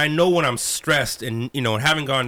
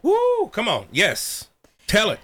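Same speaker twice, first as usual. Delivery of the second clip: excited call and response; 7 semitones higher, slower than usual. Real, not acted.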